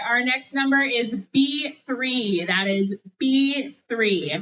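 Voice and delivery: bingo caller. Acted. Calling bingo number slowly.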